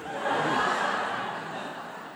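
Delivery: quiet unvoiced chuckle; breathy laugh after the punchline; soft breathy laugh. Real, not acted. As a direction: laugh under your breath at the joke. Laughing at his own punchline.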